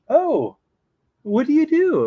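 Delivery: high, loud nanny voice; hammed-up, eager storybook nanny; Mary Poppins. Nanny asking the staff about their job, high put-on voice. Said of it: "And she goes".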